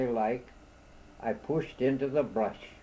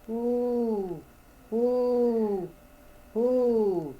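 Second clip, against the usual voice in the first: high, drawn-out owl hoot; 9 semitones higher, much slower than usual. A narrator's reading. Owl answering the lost man, high hooting. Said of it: "said a voice right over me head".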